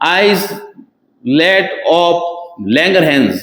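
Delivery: islet of Langerhans, each word stretched very slowly; drawn-out term. Shown as a demonstration. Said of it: "teacher drills the term slowly". (शब्द "islet of" को बहुत धीरे खींचकर बोलना)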